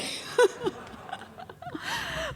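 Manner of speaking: a high burst of laughter. Real, not acted. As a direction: let a bright laugh spill out. Laugh after teasing friend.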